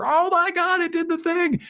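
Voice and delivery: very high, giddy exclamation; thrilled squeal. Retold or imitated. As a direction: burst out like you just won. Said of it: "And then I'd come out".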